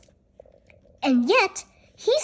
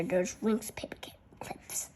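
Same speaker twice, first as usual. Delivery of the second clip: faint whisper about the picture; barely audible whisper; whispered aside; 8 semitones lower, 12 dB quieter than usual. Real, not acted. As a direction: whisper it to yourself.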